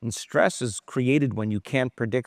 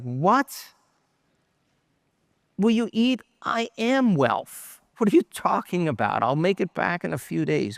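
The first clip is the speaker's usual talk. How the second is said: shocked; 5 semitones higher than usual. Retold or imitated. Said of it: "like, really shocked".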